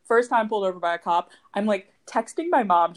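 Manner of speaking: usual speaking voice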